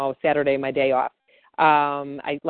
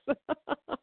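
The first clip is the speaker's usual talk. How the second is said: soft laugh; 10 semitones higher, 10 dB quieter than usual. Real, not acted. Laughs after own quote.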